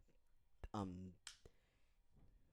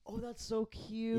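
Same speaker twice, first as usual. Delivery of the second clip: high cooing; 13 semitones higher, slower than usual. Real, not acted. Cooing over friendly countries.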